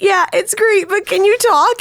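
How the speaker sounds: high, wobbly fake-tearful voice; mock crying Kim Kardashian. Girlfriend mimics a sobbing Kim Kardashian for friends, high mock cry. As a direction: squeak it out like a teary celebrity on a call. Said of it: "And she's crying".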